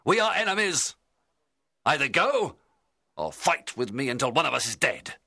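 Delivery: high, loud, defiant refusal; crying out. A narrator's reading. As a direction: issue an angry challenge to an enemy. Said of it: "I cried".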